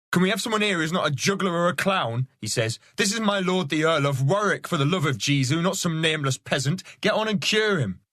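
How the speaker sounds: high, exasperated soldier voice; a soldier losing patience with her; exasperated man-at-arms. Acted. Man-at-arms scolding Catherine about his lord, exasperated.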